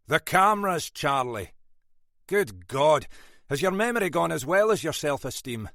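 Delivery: loud, scolding Scots boss; lightly voiced gruff Scot, fed up; exasperated Scottish inspector. A narrator's reading. Inspector scolding forgetful sergeant, exasperated Scots voice.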